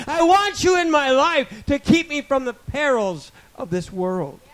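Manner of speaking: high, loud, impassioned prayer; fervent prayer. Acted. Pastor praying aloud to God, impassioned. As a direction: pour it out to God at full force. Preaching at full force.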